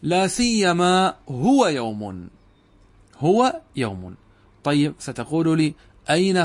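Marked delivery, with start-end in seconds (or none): 0.0-2.3 slow, deliberate stress on هو, with يوم drawn out; teacher unpacking the hidden هو; slow spelled-out reading. Shown as a demonstration. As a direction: spell out لا سيما هو يوم word by word. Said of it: "تقديره".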